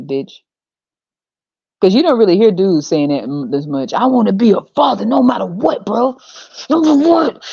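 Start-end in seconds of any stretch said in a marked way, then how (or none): 3.9-7.4 high, hammed-up parody of eagerness; mock wannabe father. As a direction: overdo a dude's declaration of fatherhood. Mocks dudes declaring they want fatherhood.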